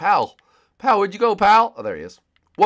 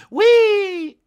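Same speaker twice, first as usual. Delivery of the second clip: gleeful squeal; 12 dB louder than usual. Real, not acted. Jumping off in game.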